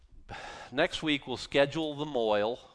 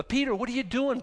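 Paul rebuking Peter; 10 semitones higher than usual. Retold or imitated. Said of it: "Paul says".

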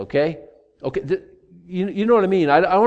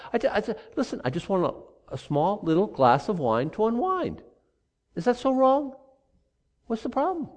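higher, softer wheedling plea; pleading innocence; 4 semitones higher, 4 dB quieter than usual. Pretend. Pastor voicing a drinker pleading with a critic, wheedling. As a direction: plead like it's the smallest thing.

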